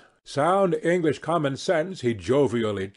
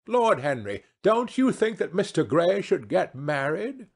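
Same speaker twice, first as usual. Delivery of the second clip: society hostess; 6 semitones higher than usual. Acted. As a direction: lecture him fondly as a grand hostess. Voices the hostess in dialogue.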